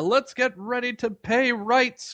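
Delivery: slow, high, drawn-out fight-announcer call; ring announcer. Retold or imitated. Guessing at the boxing commentator, mock ring-announcer call. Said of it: "you probably don't know who Howard Cosell is".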